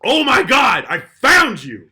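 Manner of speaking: high, loud gotcha yell; triumphant mock shout. Podcaster tracking down a fake rich kid, triumphant shout. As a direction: crow it slowly at the one you caught. Acted confrontation of exposed scammer.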